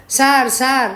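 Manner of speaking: mildly alarmed pleading; panicky. A narrator's reading. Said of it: "కంగారుగా అన్నాడు".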